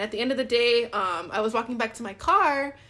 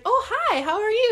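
high, loud delighted greeting; friendly surprise; 12 semitones higher, 6 dB louder than usual. Retold or imitated. Optician greeting a returning customer, pleasantly surprised. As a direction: greet someone with bright surprise. Crush greets her at the shop.

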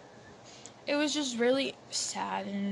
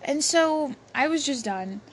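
ordinary delivery

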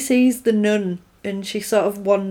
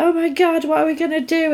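high-pitched, hammed-up fretting; mock panic; 7 semitones higher, faster than usual. Retelling the trapped group's reaction, mock panic.

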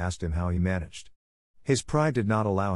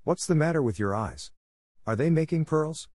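put-on mildly astonished child, pitch lifting on the last question; a touch of puzzled amazement; surprised exclamation; 7 semitones higher than usual. A narrator's reading. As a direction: ask in innocent wonder. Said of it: "His surprise was great, and he exclaimed".

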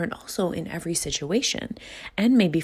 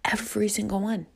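whispered; 6 semitones higher than usual. Real, not acted. Whispered emphasis.

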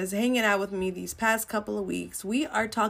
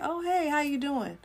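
breezy greeting; 7 semitones higher, 4 dB quieter than usual. Retold or imitated. Imitating someone's casual greeting.

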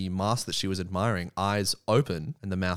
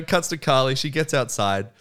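normal talking voice

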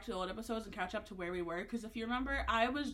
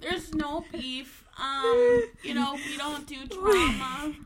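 slow, high, put-on voice; mock serious denial; 5 semitones higher, 7 dB louder, much slower than usual. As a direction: deny it like a tabloid star.

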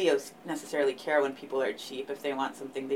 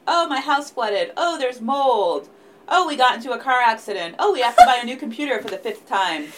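higher, louder string of mock complaints; mock exasperated; 7 semitones higher, 5 dB louder than usual. Two Virgos listing their endless bad luck, mock exasperated. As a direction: pile up the disasters in a hammed-up whine. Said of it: "It's like".

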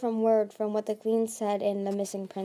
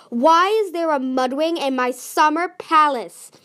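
high, very loud, slower demand; outraged shout; 6 semitones higher, 10 dB louder than usual. A narrator's reading. Queen Coral finding Clay in her palace, shouting in outrage. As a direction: royal outrage at an intruder.

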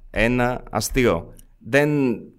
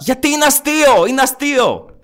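high, raised-pitch insistence; insistent protest; 9 semitones higher, 4 dB louder than usual. Real, not acted. Co-host defending his remark as a joke, high insistent protest. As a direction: protest it loudly, pitched right up.

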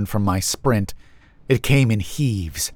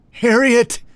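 high, strained wheezy call; wheezing shout; 13 semitones higher, 4 dB louder than usual. A narrator's reading. Exhausted narrator shouting to Harriet, high wheezing shout. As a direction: shout the name while short of breath. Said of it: "this time wheezing".

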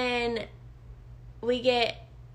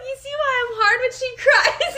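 very high quote through laughter; helpless laughter pushing the voice up; cracking up; 12 semitones higher, 4 dB louder than usual. Retold or imitated. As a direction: repeat the punchline while losing it. Repeats husband's joke, cracking up.

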